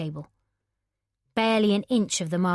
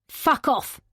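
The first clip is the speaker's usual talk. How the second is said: higher, louder shout right into her ear; higher, louder furious shout of Fuck off; 7 semitones higher, 4 dB louder than usual. Acted. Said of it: "he shouted directly into her ear".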